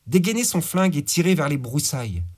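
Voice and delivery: ordinary delivery, nothing marked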